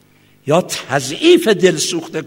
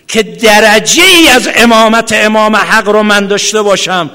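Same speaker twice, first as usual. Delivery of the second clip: ordinary talk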